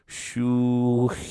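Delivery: slow, odd, mumbled voice; an odd, slow mumble; peculiar mumbled syllables. A narrator's reading. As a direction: mumble it strangely and slowly. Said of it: "Again the fish said".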